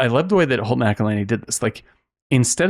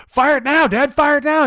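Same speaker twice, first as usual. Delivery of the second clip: much higher and louder, insistent; manic demand; 4 dB louder than usual. Retold or imitated. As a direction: push Dad like a wired, unstable son. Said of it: "and he's like".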